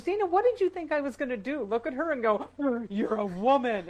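a hint of a no-nonsense mother; mom's voice. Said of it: "And she goes".

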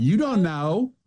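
lightly voiced Southern twang; mock hillbilly. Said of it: "hillbilly Gomer Pyle voice".

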